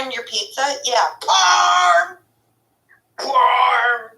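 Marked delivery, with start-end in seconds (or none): 1.2-2.2 high, loud yelled catchphrase; shouted catchphrase. Pretend. 3.2-4.1 high shouted word through a laugh. Pretend.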